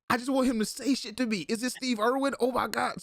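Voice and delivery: high, eager fan gush; breathless fangirl thrill; starstruck excitement. Retold or imitated.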